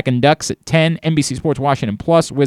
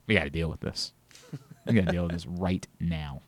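grim mutter; 8 semitones lower, 16 dB quieter than usual. Pretend. Grim mock resolve after outburst.

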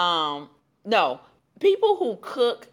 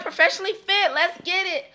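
higher, pumped-up brand line; hyped business intro; 10 semitones higher than usual. Retold or imitated. Introducing her business to the new client, pumped up. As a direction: sell it like a fired-up coach. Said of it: "I'm like".

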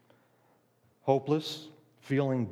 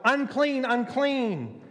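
a raised, high warning cry; yelled warning; 7 semitones higher, slower than usual. Retold or imitated. Leper shouting others away in a raised cry. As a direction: shout it to drive people off. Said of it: "Did he yell".

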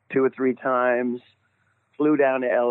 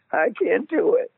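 high, squeaky mock cowardice; thin high voice, losing nerve; panicky backing out; 11 semitones higher than usual. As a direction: imitate yourself chickening out. Retells chickening out of audition.

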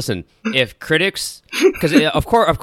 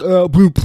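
higher, louder spluttered "bloop"; mock voice exercise; 7 semitones higher, 6 dB louder than usual. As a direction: imitate silly speech-therapy lip noises. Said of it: "him in the room going like".

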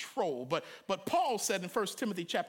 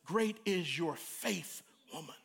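slow, softer reassurance; gentle praise; 4 semitones lower, 5 dB quieter, slower than usual. A narrator's reading. Jesus gently praising the Canaanite woman. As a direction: speak it kindly, slowly. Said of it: "And Jesus says".

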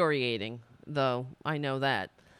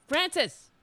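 sudden high-pitched yell of a name; 10 semitones higher than usual. Real, not acted. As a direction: call out sharply to a misbehaving pet. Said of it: "I'm sorry for yelling into the mic".

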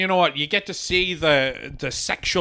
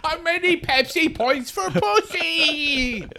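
mock crying; 12 semitones higher, slower than usual. Mock pleading after a laugh.

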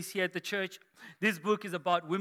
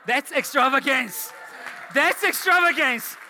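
high, loud burst of amazement; amazed exclamation; 5 semitones higher, 5 dB louder than usual. Retold or imitated. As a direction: exclaim it to the whole room, climbing higher. Exclaiming at the hosts' generosity.

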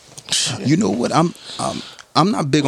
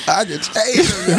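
higher, shouted echo; 10 semitones higher than usual. Retold or imitated. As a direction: yell it out in a high voice.

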